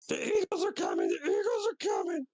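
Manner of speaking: very high falsetto shriek; mock scream. Player's tiny character flees out the door, screaming. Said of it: "fly out screaming".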